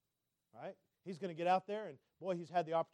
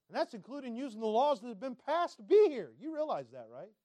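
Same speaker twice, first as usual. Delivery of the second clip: ordinary talk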